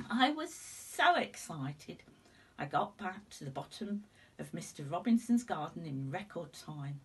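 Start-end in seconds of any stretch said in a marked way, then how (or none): none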